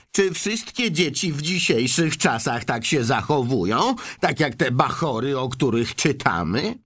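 put-on grandma tutting about modern children; indignant old grandma. Grandma Georgina complains.

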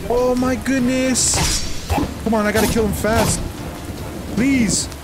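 high, stressed protest; panicked. Real, not acted. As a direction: plead as things fall apart. Undead army attacks mid fight.

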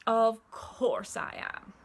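slow, loud, smug confirmation; gloating crocodile. A narrator's reading. Crocodile confirms his plan to monkey, slow gloating. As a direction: stretch it with wicked relish.

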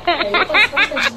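laughing. Real, not acted. Laughs while doing makeup.

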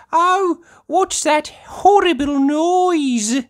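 high, loud, slow complaint; dismayed cry. A narrator's reading. Missus Corgi hears a horrible noise, dismayed cry. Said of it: "cries Missus Corgi".

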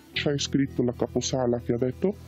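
ordinary talk

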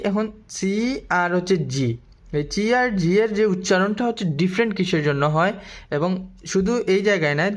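ordinary talk, nothing marked

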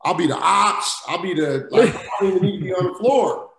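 animated retelling of a desperate plea; retold begging. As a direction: beg the coach for any job, half-joking. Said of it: "I'm begging".

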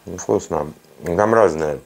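speaking between bites; chewing while talking. Real, not acted. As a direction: mouth partly full. Seen 0: Bit burger at -7, still chewing while speaking.

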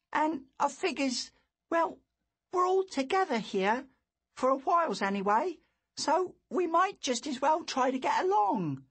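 put-on high sincere boy voice; a boy making peace; earnest boy. A narrator's reading. Boy Lou reasons.

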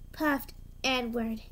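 usual speaking voice

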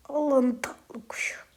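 soft, tender whisper; whispered fond praise; cooing whisper. Real, not acted. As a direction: coo it softly like to a pet. Cooing at an in-game bird.

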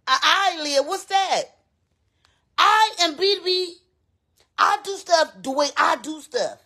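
a high, haughty diva voice to Linda; sassy diva persona. Acted.

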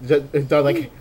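ordinary delivery

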